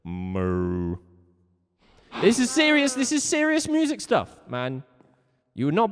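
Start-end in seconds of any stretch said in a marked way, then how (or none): 0.0-1.0 low, drawn-out moo; long low imitation cow. Shown as a demonstration. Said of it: "A cow-sized cow goes moo". 2.2-4.8 high, loud insistence; raised, high-pitched indignation; insistent protest. Real, not acted. Insisting the silly lesson is serious.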